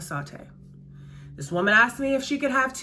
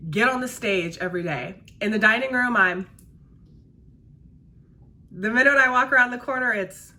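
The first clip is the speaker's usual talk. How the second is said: ordinary talk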